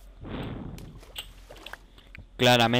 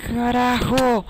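pained yelling; 10 semitones higher, much slower than usual. Real, not acted. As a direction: cry out as you burn. Burning in game, cries out.

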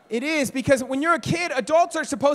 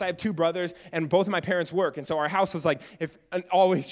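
usual speaking voice

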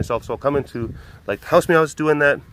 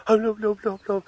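much higher, fussing no no; fretful animal; 9 semitones higher, 4 dB quieter than usual. Retold or imitated. The pet fussing at her pal, high and fretful. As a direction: fuss over him worried. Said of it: "Noe was just like".

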